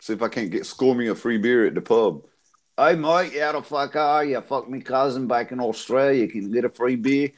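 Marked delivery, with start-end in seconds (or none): 2.8-7.3 broad Aussie bogan imitation; Australian accent. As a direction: chat up the pub like an Australian. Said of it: "I sound like an Australian cousin fucker".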